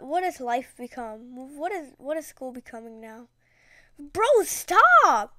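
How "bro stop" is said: high, loud exclamation; outburst. Real, not acted. Telling viewers about a weird classmate, a high, loud outburst. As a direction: burst out in disbelief.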